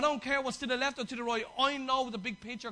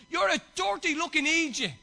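higher, louder mock insult at a teammate; 6 semitones higher, 8 dB louder than usual. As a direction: bark it like a sore teammate. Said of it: "the other fella goes".

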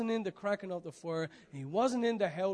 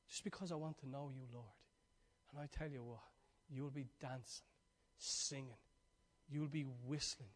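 much softer, intimate preaching voice; hushed preaching; 4 semitones lower, 12 dB quieter than usual. Real, not acted. Preacher promising joy to the congregation, much softer. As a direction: lean in and speak it softly.

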